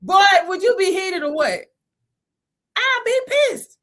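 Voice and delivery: mock outrage. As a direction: act scandalized about losing your money. Mock outrage over a lost refund.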